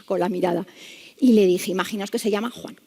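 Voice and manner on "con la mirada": ordinary talk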